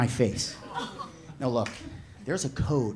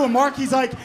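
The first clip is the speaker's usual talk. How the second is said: ordinary delivery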